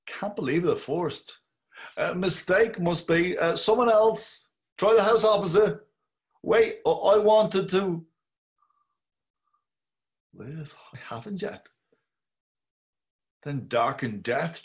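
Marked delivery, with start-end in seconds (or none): 2.0-8.0 high, loud, pleading denial of death; frantic dying plea; panicked protest. Acted. Imagined dying man's protest. 10.3-11.7 faint last breath. Acted. Dying plea fades.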